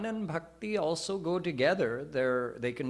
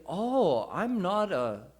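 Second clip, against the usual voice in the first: amazed realisation; 6 semitones higher, 3 dB louder, slower than usual. Retold or imitated. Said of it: "Then he said".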